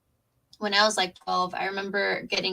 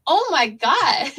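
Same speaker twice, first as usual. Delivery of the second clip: amazed oh my god; 7 semitones higher, 7 dB louder, much slower than usual. Retold or imitated. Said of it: "I was just like".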